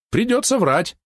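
carefree. A narrator's reading. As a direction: toss it off carelessly. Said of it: "беззаботно откликнулся Розмич". (беззаботно)

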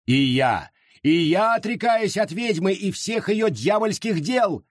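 high yelled vow; yelling. A narrator's reading. Jane yells to the crowd, high and fervent. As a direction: howl out your renunciation. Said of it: "завопила Джейн".

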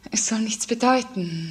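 normal talking voice

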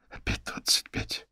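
a weary, breathless whisper under her breath; whispered, fed-up grumble. Acted. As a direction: sigh the words out as a tired aside.

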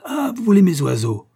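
high, loud cry of outrage; beside himself. Acted. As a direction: explode on it, starting high and falling. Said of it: "répéta le gentilhomme hors de lui".

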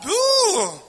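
high excited whoop; joyful whoop. Acted. Preacher whooping for joy before the church.

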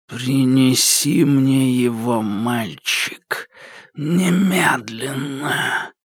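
slow menacing creature voice sinking to a whisper; creeping nightmare whisper. Acted. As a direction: order it like a nightmare breathing close. The shadow commands the boy.

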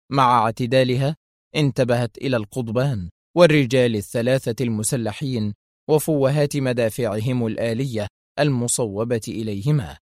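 ordinary delivery, nothing marked

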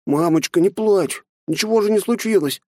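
put-on high pleading little boy; child's voice. A narrator's reading. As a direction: soothe mother as a boy. Child comforts crying mother. (детский голос)

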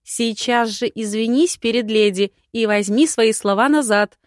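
loud, stern mother's command; mother scolding her son in public; barked order. A narrator's reading. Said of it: "гаркнула она".

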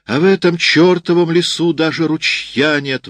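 the sergeant bursts out loud; exclaiming. A narrator's reading. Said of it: "воскликнул сержант". (громкий возглас сержанта)

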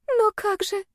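very high, small plaintive voice; helpless girl. A narrator's reading. Girl told he isn't hers, high helpless protest.

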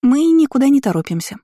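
high-pitched little sister's chime-in; girlish coaxing. A narrator's reading. Youngest twin in no hurry to leave, high coaxing. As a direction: pipe up high, backing your sister. Twin coaxes mage to stay.

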